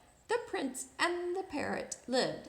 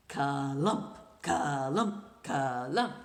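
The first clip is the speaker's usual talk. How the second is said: deep, heavy ka-lump like a horse's hooves; low clomping hoofbeats; 11 semitones lower, slower than usual. Acted. Reading to a child, the prince's horse clomping closer. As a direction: stomp each syllable out low and heavy.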